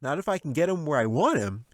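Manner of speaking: put-on confident kid with a plan; high quick boyish voice; eager boy. A narrator's reading. Jack voice held.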